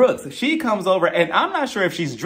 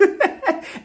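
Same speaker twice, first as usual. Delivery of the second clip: real laugh; 14 semitones higher than usual. Real, not acted. Laughs at own confusion.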